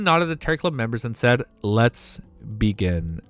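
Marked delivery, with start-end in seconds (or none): 1.6-3.2 much lower, very slow and grave; low, slow, grave deity voice; solemn god voice. Acted. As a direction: intone it like an ancient deity. Dramatic line of the Fool.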